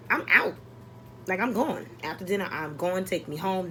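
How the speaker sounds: normal talking voice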